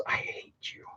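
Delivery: hissed under the breath; whisper. Real, not acted. Griping about square bolts, a venomous whisper. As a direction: whisper the hate to yourself. Muttering at square bolt users.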